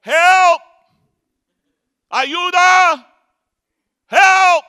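high yelled calls for help; frantic high shouts; desperate shout. Retold or imitated. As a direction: holler help across the sea. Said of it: "Yo empecé a gritar".

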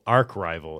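ARCH RIVAL said "ark rival"; hard K closing ARCH in ARCH RIVAL; odd pronunciation. Shown as a demonstration. Host recalling a word he says wrong, ARCH RIVAL as "ark rival". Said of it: "what was the one word".

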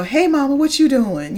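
butter up mom before asking a favor; sweet-talking kid. Retold or imitated. Kid sweetly greeting mama to ask a favor.

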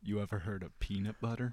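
low, hushed salesman-like impression; George Washington Carver. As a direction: confide it very softly and low. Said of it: "Give me your best George Washington Carver".

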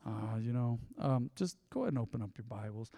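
low, soft, listless mumble; a gloomy preacher who can't be bothered; mock bummed-out pastor. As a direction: drag through it like you've no energy. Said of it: "I was like".

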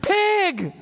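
high, loud mock yell of a woman; acted high yell; yelled at the top of her lungs. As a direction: shout it out a car window as she flies past. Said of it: "yelled at him at the top of her lungs".

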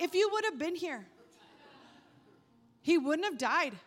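mock-sassy Martha in a vague put-on accent; odd accent. Martha scolding Jesus for coming late, hand on hip, in a half-put-on accent. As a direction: hand on hip, a lightly voiced indignant Martha. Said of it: "I don't even know what that accent was".

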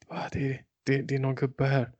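soft, intimidated murmur; hushed phone reply. Retold or imitated. Imitating the friend cowed by a shouting old man, hushed. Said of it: "du blev helt tyst".

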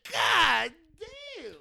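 high, drawn-out cry; awed exclamation. Retold or imitated. Fan reacting to Barry's song, high wailing exclamation.

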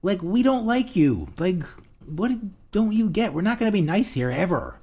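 high, loud, exasperated rant; ranting Jersey reply. Retold or imitated. Answering critics of New Jersey, loud exasperated rant. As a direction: push it hard like you're fed up.

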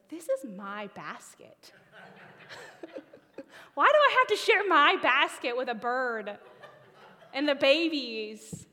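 loud, laughing protest to the Lord; half-laughing mock grievance, loud; laughing complaint. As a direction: whine through laughter about the basket. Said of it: "I was like".

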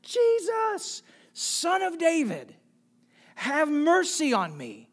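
high-pitched, urgent cry for mercy; shouting. A narrator's reading. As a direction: call out high, stretched, to someone passing by. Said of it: "he began to shout".

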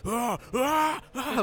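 high, loud mock scream; imitated screaming. Fan watching a soccer game, mock screaming at the TV. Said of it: "like, just screaming".

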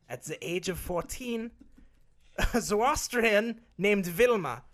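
loud, hammed-up German accent, Arnold style; Schwarzenegger German accent. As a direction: boom it out like Arnold doing a monologue. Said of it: "I like this better".

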